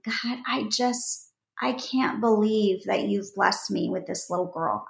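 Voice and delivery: hushed grateful prayer to God; retold tearful prayer. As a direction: recall a tender whispered prayer. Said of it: "I'm just weeping before the Lord, saying".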